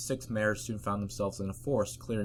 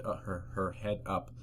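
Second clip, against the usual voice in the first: usual speaking voice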